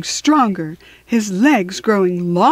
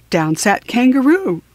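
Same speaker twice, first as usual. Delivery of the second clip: ordinary delivery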